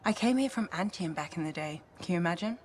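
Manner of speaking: ordinary delivery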